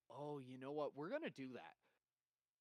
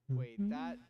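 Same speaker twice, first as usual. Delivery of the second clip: alarmed; 6 semitones higher, 14 dB louder than usual. Real, not acted. Sudden realization.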